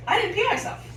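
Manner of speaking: high-pitched happy realization; retold moment of proud relief; delighted relief. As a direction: lift it high, thrilled with yourself. Waking up dry, relieved.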